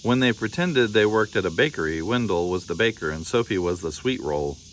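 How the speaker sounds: usual speaking voice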